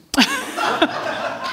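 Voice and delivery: a real giggle pitched high and soft; giggle, pleased and amused; laughing at feeling cute, a high soft giggle. Real, not acted. Laughing at the funny story.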